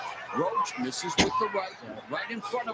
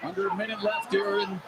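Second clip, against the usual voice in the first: golf commentator; 9 dB quieter than usual. Acted. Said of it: "golf commentator voice".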